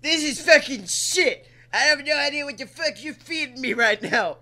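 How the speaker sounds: mock Guy Fieri. Guy Fieri insulting his own food, hammed-up. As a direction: rant like a furious TV chef. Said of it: "insulting people the way Gordon Ramsay does".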